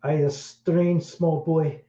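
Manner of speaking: lightly hammed-up Scottish medium sensing a spirit; mock Scottish psychic. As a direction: channel a ghost in a put-on Scottish accent. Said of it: "I'm not even doing it right".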